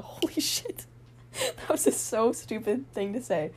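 breathless laughing at her own words; embarrassed laughter. Real, not acted. Laughing at herself for a dumb remark to Michaela, breathless. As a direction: laugh it off, half crying. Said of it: "That was so dumb".

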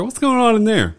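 confused guard. Retold or imitated. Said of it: "They're like".

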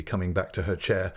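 ordinary delivery, nothing marked

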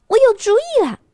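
high, loud excited shout; sudden shout. Acted. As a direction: burst out with the idea. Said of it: "可它突然大喊".

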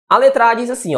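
usual speaking voice

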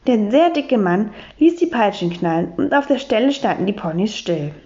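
ordinary delivery

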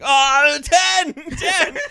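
high, loud, giddy mock executive; mock Apple exec. Apple bosses rushing to ten, squealing high and loud. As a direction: squeal it like a tech boss who can't wait. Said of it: "They were just like".

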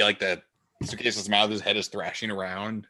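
usual speaking voice

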